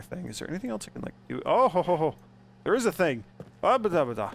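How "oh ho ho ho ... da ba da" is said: excited. Real, not acted. Delighted noises at discovery.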